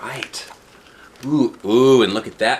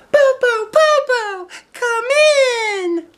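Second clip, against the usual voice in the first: very high, loud happy calling; excited child shout; 7 dB louder, slower than usual. Acted. Two little children welcome grandmother with a high excited shout. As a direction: shout for Popo to come in, thrilled. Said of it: "They shouted".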